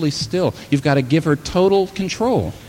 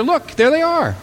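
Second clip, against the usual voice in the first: much higher, rising with joyful excitement; excited cry; 14 semitones higher than usual. Acted. Preacher voicing Jesus showing the healed crowd, excited high cry. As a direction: cry it out like pointing at a miracle.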